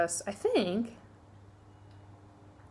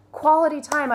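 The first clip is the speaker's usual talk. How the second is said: higher, louder burst of remembering; sudden recall; 8 semitones higher, 6 dB louder than usual. Real, not acted. Vlogger finally recalls her favorite love language, relieved burst. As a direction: blurt it out as it comes back to you.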